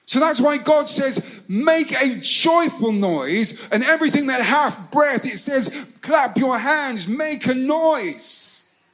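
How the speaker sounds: high, ringing exhortation with drawn-out endings; impassioned preaching. Real, not acted. Preacher urging congregation to make a joyful noise, impassioned. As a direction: preach it high and stretch the key words.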